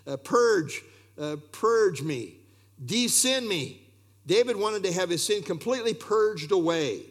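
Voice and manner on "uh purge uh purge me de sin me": "purge me" and "De-sin me" said slowly, each word dwelt on; savoring the words. Shown as a demonstration. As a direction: linger on "De-sin me", slow and deliberate. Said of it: "Isn't that interesting?".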